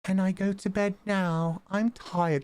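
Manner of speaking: put-on high little British child, like Peppa Pig; high-pitched mock child asking for bed; imitating British child voice. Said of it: "does kinda sound like Peppa Pig".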